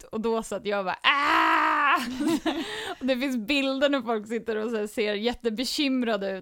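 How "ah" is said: loud, high re-enacted burst of laughter; imitated guffaw. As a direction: let out a mock open-mouthed roar of laughter. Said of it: "rågarvar".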